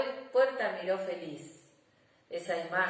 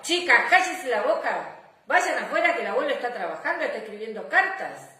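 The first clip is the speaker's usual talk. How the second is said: scolding mother; 5 semitones higher, 7 dB louder than usual. Acted.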